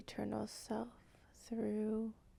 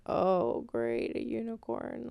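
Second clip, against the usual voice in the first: unfazed, jaded; 4 dB louder than usual. Retold or imitated. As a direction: act out someone tired of fantasy, unimpressed. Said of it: "the tone that I got ... not really fazed".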